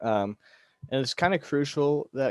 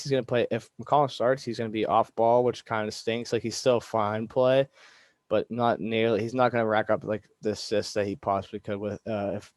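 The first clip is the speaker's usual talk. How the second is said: usual speaking voice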